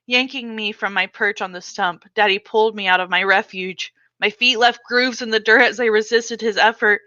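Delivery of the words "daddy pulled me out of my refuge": ordinary delivery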